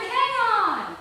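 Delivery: loud, high alarmed holler. A narrator's reading. Man on the shore hollering at the drowning woman. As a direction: bellow out in alarm. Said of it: "he hollered".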